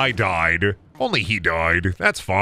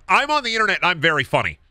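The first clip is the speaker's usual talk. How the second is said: higher, fast mocking quote; mocking impressionist; 5 semitones higher, faster than usual. Mocking internet impressionists, fast raised voice. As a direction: rattle it off mockingly. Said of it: "That's like the".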